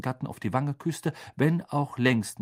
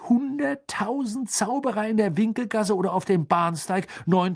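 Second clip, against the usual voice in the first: lightly voiced Mr Weasley, animated and higher; explaining father figure; 6 semitones higher than usual. Mr Weasley explains logistics to Harry, lightly voiced animated father. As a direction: explain the problem with lively, rising emphasis.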